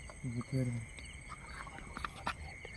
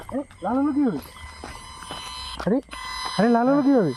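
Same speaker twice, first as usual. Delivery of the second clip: surprised; 4 semitones higher than usual. Real, not acted. Meter suddenly turns red.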